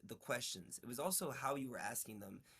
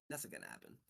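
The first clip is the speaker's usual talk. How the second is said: much lower, soft voiced friend; flat low refusal from the back seat; friend refusing; 6 dB quieter, faster than usual. Friend in the car flatly refuses, low and soft.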